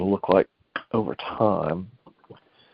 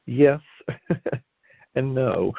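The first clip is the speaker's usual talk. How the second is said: higher, with a laugh; 4 semitones higher, much slower than usual. Real, not acted. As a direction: chuckle through it. Laughing yes and no.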